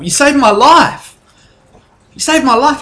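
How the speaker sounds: high and loud, overwhelmed thanks; gushing. Acted. Barmaid thanking heroes, high excited gush.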